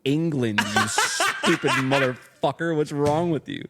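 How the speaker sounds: an angry Englishman telling off the clerk; a laughing British accent, slow and loud; British guy. Retold or imitated. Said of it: "the guy was like".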